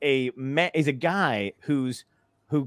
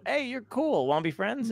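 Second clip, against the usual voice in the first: lightly acted, higher-pitched chummy greeting; eager friendly approach; 7 semitones higher, 5 dB quieter than usual. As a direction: play chatting up a stranger to make friends. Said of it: "approach people I don't know and go".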